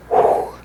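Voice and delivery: demonstrative puff of air blown out; imitated exhale; imitated blow of breath. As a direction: blow out sharply, no voice. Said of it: "blows out".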